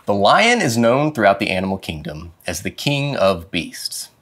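plain reading voice

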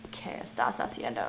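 hushed, low whisper; nervous whisper. Real, not acted. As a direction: whisper warily to someone creepy. Nervously addresses figure in game.

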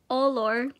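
ordinary talk

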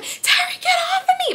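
squealed scream at reduced volume; retold scream. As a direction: reenact a shriek, thin and high. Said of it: "I just screamed".

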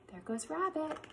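ordinary delivery

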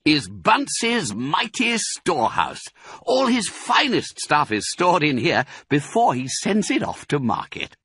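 high, showy presentation; proudly proclaiming. A narrator's reading. Fox shows Badger Bunce's storehouse, proudly proclaiming. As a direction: present it like a treasure. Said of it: "proclaimed Mister Fox".

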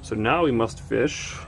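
ordinary talk

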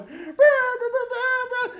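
high, slow, laughing whoops; mock crowd cheering through laughter; laughing cheers. Drivers cheering from their cars, slow laughing whoops. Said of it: "People are going".

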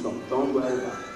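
ordinary talk

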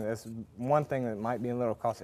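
ordinary talk, nothing marked

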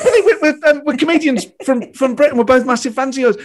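high-pitched, stumbling fan introduction; starstruck gushing. Retold or imitated. Two comedians introducing themselves to their hero, gushing. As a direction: trip over your words, thrilled to meet him.

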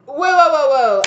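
high, loud burst of amazement; stunned whoa. Pretend. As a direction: blurt it out like the news just floored you. Stunned by a casual bombshell.

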